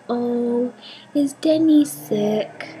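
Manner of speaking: put-on high, slow worried little-girl question; a tiny girl asking with concern; toddler voice.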